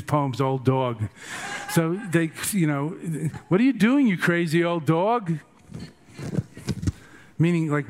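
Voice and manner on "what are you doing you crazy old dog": mock shout. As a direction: yell it at him like scornful monks. Monks mock naked teacher.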